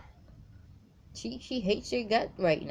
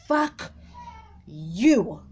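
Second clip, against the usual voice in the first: channeled angry curse; 5 dB louder than usual. Pretend. Reader voicing the woman's rage at the man, angry shout. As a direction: spit it out as her rage. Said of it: "channeling her energy".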